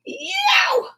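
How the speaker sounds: very high cry in a changed voice; high yelp. Acted. Oliver yelping as the family tugs again. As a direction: yelp sharply.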